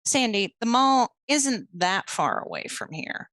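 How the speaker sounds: usual speaking voice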